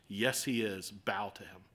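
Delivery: ordinary talk, nothing marked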